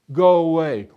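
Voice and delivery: loud, drawn-out rebuff; dismissive shout. A narrator's reading. Villagers turning away a hungry soldier, loudly dismissive. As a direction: shoo him off loudly.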